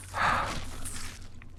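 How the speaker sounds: long steadying exhale; boy's acted calming sigh; catching breath. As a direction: breathe out to collect yourself. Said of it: "the boy catches his breath".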